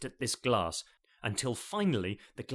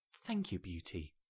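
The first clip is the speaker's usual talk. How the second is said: much softer, tender, fading thanks; 5 semitones lower, 9 dB quieter than usual. Acted. Jane in her husband's arms after the kiss, tender. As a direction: murmur it, moved.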